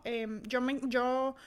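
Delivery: ordinary talk, nothing marked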